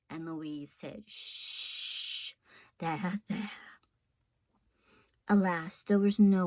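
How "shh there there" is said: soft, hushed comfort; calm her like a crying child; soothing hush. A narrator's reading. Louise soothing the weeping cook, soft and hushed.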